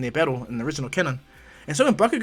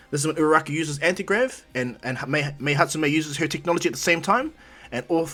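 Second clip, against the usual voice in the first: usual speaking voice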